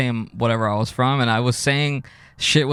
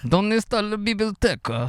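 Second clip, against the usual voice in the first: high-pitched, laughing fake foreign accent; comic Spanish-Italian accent, giggly; mock foreign accent; 10 semitones higher than usual. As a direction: ask it like a hammed-up tourist gone native. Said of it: "you've been living in Italy".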